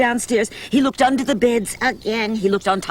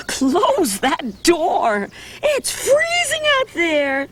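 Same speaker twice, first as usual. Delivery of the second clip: mother; 7 semitones higher, much slower than usual. Acted. Mother voiced in story.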